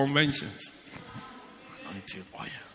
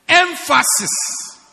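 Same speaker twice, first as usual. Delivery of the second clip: Emphasis drawn out slowly, higher and louder; slow emphatic word; 8 semitones higher, 9 dB louder, much slower than usual. Shown as a demonstration. Preacher announcing his next point emphatically. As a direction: stretch Emphasis out for the congregation. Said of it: "The next one he says is what?".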